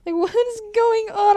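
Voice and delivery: high, loud, laughing what; laughing confusion. Retold or imitated. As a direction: laugh out a baffled question. Confused laugh at eyebrow wiggle.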